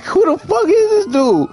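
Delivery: high-pitched mock outrage; incredulous demand. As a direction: act out disbelief with the pitch jumping up. Retold demand about the stranger.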